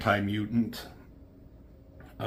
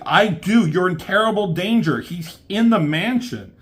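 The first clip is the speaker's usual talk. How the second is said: high, loud warning cry; raised urgent warning; urgent alarm; 8 semitones higher, 7 dB louder than usual. Retold or imitated. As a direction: sound the alarm. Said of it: "It's like".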